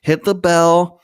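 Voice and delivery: hammed-up outro.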